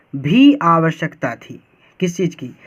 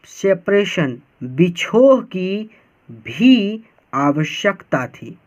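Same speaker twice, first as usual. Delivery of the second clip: slow, each word broken apart with pauses; deliberate teacher's slow reading; slower than usual. Shown as a demonstration. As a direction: read it out piece by piece for learners. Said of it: "बहुत slow पढ़ा रहा हूँ एक एक word को तोड़ तोड़ कर".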